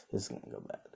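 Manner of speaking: a hushed, breathy warning to herself; worried whisper. Retold or imitated. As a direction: whisper it, afraid of what comes next. Hinata worried aside during fight.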